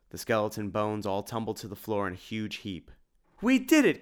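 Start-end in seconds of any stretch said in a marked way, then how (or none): 3.4-4.0 very high, loud cheer; excited cry. A narrator's reading. Tim celebrates as Dracula vanishes, excited cry. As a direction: squeal with victory. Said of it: "cried Tim".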